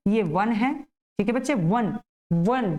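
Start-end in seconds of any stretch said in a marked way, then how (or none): 1.5-2.7 वन with a gentle v, not b; pronouncing van. Shown as a demonstration. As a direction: say वन with a clear v, stretched long. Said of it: "वन ऐसे करके".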